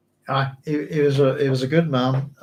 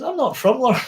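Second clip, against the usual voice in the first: high-pitched retold correction; indignant protest; 11 semitones higher than usual. Telling friends he is not from Larne, high-pitched indignation. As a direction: push the pitch right up in mock protest.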